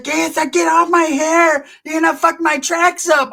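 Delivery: high-pitched imitation of a woman protesting; mock high squeal. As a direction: squeal it like someone having hair pulled. Acts Changa screaming while beaten.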